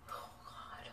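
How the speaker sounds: breathless dismayed whisper. Real, not acted. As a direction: whisper it in horror. Reacting to makeup mess.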